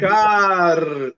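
slow, high, loud drawn-out Azucar; [name] shout. Retold or imitated. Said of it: "[name] impression".

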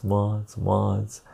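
ordinary delivery

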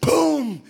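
loud high crash; imitated crash; crash sound. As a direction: bang it out like an impact. Car hits stop sign.

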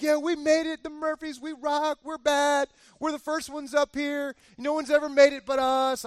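high, excited victory boasting; hammed-up triumphant crowing; boastful celebrating. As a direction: brag to the world from the summit. Acting out family celebrating at top.

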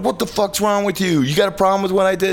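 high, fast, heated demand; retold outburst at the singer; angry confrontation. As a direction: storm in and have it out with him.

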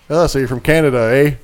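mock Canadian accent. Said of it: "And Zach's like".

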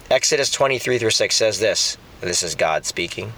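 ordinary talk, nothing marked

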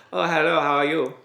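playful fake French accent; English with a put-on French accent. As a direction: imitate a Frenchman speaking English. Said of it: "speaking English with a French accent".

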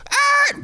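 mock screech. Car screeching onto sidewalk.

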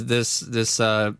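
usual speaking voice

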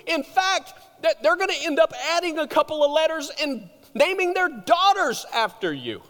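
high, loud, pumped-up hype; God as a hyped friend cheering Daniel on; excited, high and loud encouragement; excited buddy God. Pretend. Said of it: "God could have come to Daniel and said".

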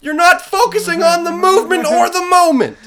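high, loud mock reprimand; psychopath dad. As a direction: scold the kids like a hammed-up crazed dad. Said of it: "Just a psychopath dad".

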